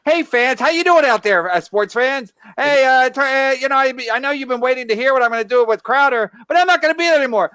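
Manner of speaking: high, bright mock sportscaster greeting; a lightly hammed-up upbeat host; Landau impression. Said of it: "Landau with that video".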